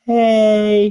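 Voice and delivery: put-on high girly hey; girl's voice. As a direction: answer like a young girl. Daughter answers the dude.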